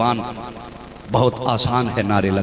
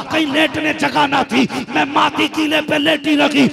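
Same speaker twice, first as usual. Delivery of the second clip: impassioned preaching; 12 semitones higher than usual. Acted. Mother reminds her son of her sacrifice, preached passionately. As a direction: preach the mother's reproach at full force.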